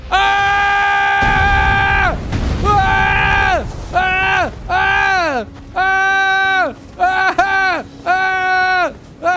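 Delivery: repeated high panicked yells of "Ah!"; panicked screams. Real, not acted. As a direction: scream in real panic as you're attacked. Ambushed by a giant in game.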